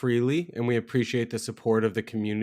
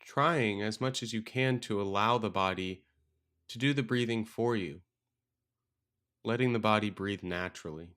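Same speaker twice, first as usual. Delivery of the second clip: calm meditation guide with long, restful pauses; unhurried, softer than a podcast intro, easing listeners in; guided meditation; 4 dB quieter than usual. Acted. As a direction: guide them gently into stillness, leaving space between phrases.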